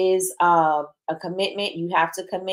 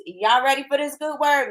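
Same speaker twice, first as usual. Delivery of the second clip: a touch of pulpit call, raised pitch; mock preacher; 7 semitones higher than usual. Host to chat viewers, mock preacher call. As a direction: tease them like a preacher opening a sermon. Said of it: "No, I'm just joking".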